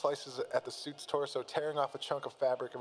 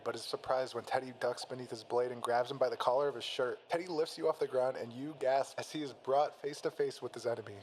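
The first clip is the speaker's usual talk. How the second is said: plain reading voice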